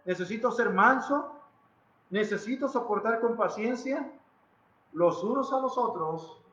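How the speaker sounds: ordinary talk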